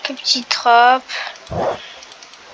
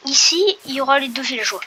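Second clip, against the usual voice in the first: ordinary talk, nothing marked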